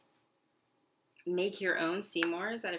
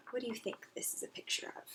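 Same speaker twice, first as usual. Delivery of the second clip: whisper; 5 semitones higher, 7 dB quieter, much faster than usual. Acted. Teacher whispers a question.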